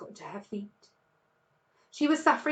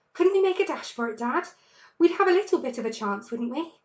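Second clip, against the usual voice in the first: put-on fast, higher, eager child's voice; eager cub; 4 semitones higher, 5 dB louder, faster than usual. A narrator's reading. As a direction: rush it, hoping Dad agrees. Cubs urging a dash out.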